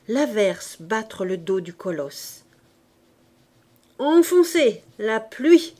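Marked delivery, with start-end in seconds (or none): 4.0-5.7 higher, louder jeering call at the rain; cheeky street-kid shout; Gavroche calling out. A narrator's reading. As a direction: shout a dare at the storm. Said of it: "dit Gavroche".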